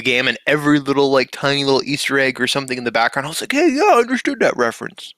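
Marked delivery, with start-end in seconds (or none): 3.5-5.1 much higher mock-excited exclamation; playful, squeaky self-quote; excited recognition. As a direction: pipe up high, delighted to catch the reference. Acts own excited reaction to references.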